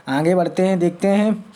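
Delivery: ordinary delivery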